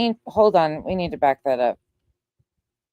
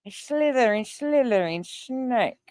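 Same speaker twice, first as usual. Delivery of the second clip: higher, softer, sibilant snake voice; mock snake lisp; 6 semitones higher, 4 dB quieter, slower than usual. Co-host mocking the lisp claim, snake hiss. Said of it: "Do I sound like that?".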